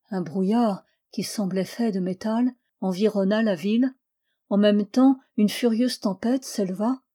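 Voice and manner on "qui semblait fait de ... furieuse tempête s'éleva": plain reading voice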